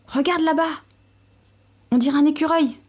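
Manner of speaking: a father wolf shouting with excitement; excited cry. A narrator's reading. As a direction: call out thrilled at spotting the squirrel. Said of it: "quand il s'écria".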